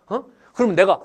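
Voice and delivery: normal talking voice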